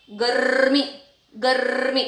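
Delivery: गर्मी as "garrr-mee"; long trill in गर्मी straight into "mee"; correct rolled r. Shown as a demonstration. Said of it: "इस तरीके से".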